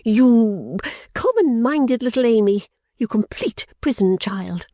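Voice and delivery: slow, high contemptuous insult; Fanny insulting her sister; angry reproach. Acted. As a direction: lash out with disdain. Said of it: "these angry reproaches".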